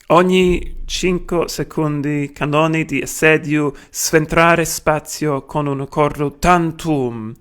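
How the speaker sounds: put-on Italian read in a broad British accent. Lecturer reading Marinetti in Italian for students, British accent. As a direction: read the Italian like a hungry Brit. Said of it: "in my British accent".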